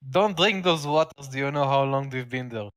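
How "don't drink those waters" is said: warning call. Acted. Warning a party member.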